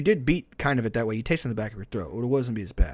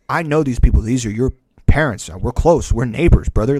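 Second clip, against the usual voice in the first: loud retold protest to a buddy; insistent protest; 9 dB louder than usual. Teen protesting to his friend about the neighbors, loud and insistent. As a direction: plead your case loudly, as if retelling it.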